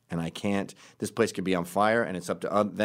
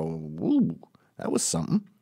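knowing aside; 4 semitones lower than usual. Retold or imitated. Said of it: "look at each other with our eyebrows up and go".

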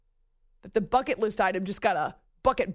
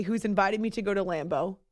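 ordinary delivery

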